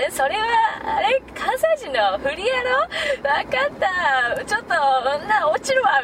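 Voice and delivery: put-on high, teasing drunk voice; drunk Kansai banter. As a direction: play the tipsy joker. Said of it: "みたいな感じで".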